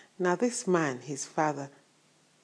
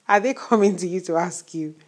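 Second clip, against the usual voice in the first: laughing; 3 semitones higher, 10 dB louder than usual. Real, not acted. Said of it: "I, I'm laughing".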